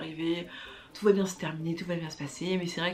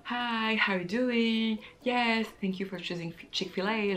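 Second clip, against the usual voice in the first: friendly cashier voice; 4 semitones higher, slower than usual. Retold or imitated. Imitating friendly Chick-fil-A staff.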